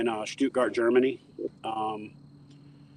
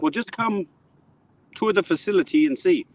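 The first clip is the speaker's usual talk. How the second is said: put-on high, German-accented coaxing; gently persuade, German CEO; coaxing German caller; 8 semitones higher than usual. Owner coaxes Chad to visit, German accent.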